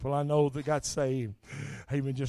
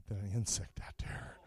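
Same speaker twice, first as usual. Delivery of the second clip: whispered aside; 5 semitones lower, 8 dB quieter than usual. Real, not acted. Preacher drops to aside.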